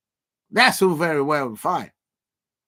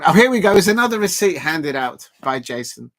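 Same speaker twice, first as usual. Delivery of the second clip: excited; 3 semitones higher, 7 dB louder than usual. Real, not acted. Mock dread at another chat receipt.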